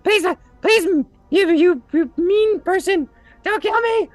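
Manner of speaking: high loud squeaky begging and flattery; grovelling kobold. Acted. Kobold flattering his captor, squeaky and desperate. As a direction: fawn desperately in a tiny high voice. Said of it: "He's like".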